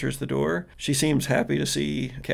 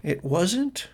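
put-on hesitant, slightly raised girl's voice; confused, near tears; 3 semitones higher, 3 dB quieter than usual. Confused girl explains the pickup ride, faltering. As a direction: falter softly through a muddled memory. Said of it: "She looks confused".

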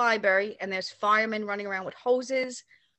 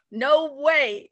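Paul Lynde's drawl; 5 semitones higher, 6 dB louder than usual. Retold or imitated. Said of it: "the funniest line with his voice when he said".

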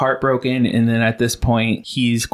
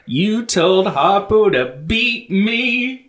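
dramatic line reading; 7 semitones higher than usual. Retold or imitated. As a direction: declaim it like an aggrieved wife. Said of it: "She didn't deliver it like Oprah did".

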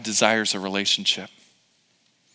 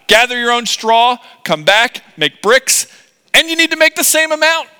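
raised, emphatic orders; Pharaoh; 9 semitones higher, 7 dB louder than usual. Acted. Pharaoh gives the Israelites their brick orders, emphatic. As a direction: lay down the rules, high and punchy. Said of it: "I'm gonna say".

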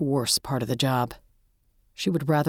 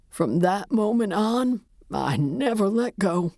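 put-on grieving old man; 5 semitones higher than usual. A narrator's reading. Widower tells the detective about young love, mournfully. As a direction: reminisce in a high, grief-worn voice.

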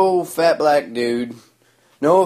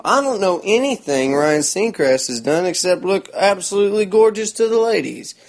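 ordinary talk

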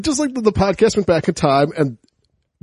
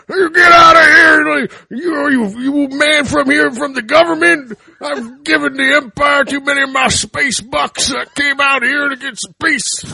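higher, loud, half-crying rant; mock ranting yell; 7 semitones higher, 4 dB louder than usual. Hermit yelling at the intruders that he came out here for peace, in a loud mock rant. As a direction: rant like a fed-up hermit, laughing through it. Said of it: "he's just like".

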